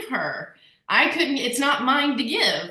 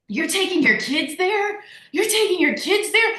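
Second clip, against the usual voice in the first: high-pitched scandalized questioning; mock disbelief; 7 semitones higher than usual. Critics questioning her move with the kids, mock disbelief. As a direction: imitate an appalled onlooker, voice pitched up.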